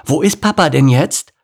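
put-on high, loud child question; high-pitched kid voice; eager lion cub. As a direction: ask it as an impatient little girl. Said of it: "fragte Laila".

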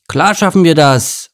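bright group reply; eager animal chorus. Acted. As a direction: answer keenly as the whole troupe. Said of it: "erwiderten die Tiere".

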